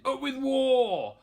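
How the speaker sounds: high, loud and drawn out; roaring war cry. A narrator's reading. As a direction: bellow it to the pack. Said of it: "they shouted".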